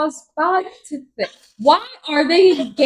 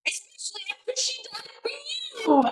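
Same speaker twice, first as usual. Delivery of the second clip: bitter whispered aside; soft bitter whisper; 13 semitones higher, 8 dB quieter than usual. Real, not acted. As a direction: hiss it under your breath.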